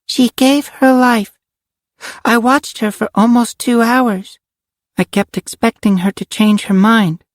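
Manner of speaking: put-on high little-girl voice; a child's high voice, recounting gravely; little girl Karen, solemn. Karen tells of Cassie's sacrifice, solemnly.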